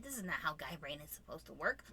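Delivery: low breathy whisper aside; hushed aside to partner. Real, not acted. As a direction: whisper it like a secret. Unvoiced whisper.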